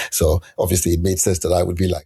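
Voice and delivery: ordinary delivery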